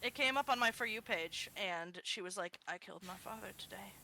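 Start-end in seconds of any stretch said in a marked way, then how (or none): 2.7-3.9 very soft, quick confession in a put-on voice; eerily quiet confession. Woman confessing on TikTok, imitated in a hushed voice. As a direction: imitate a confession, barely audible. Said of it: "don't use that voice".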